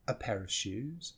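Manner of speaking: "a" in "A pair of shoes" as a short schwa; reduced weak "a". Shown as a demonstration. Teacher showing a whole word becoming schwa, reduced. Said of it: "a whole word can become this sound".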